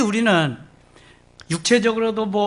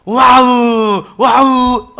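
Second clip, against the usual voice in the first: put-on loud, high delighted wow like a toddler; imitating toddler's wow; 5 semitones higher, 8 dB louder, much slower than usual. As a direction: shout wow like a thrilled baby. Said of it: "이러는 거 있죠".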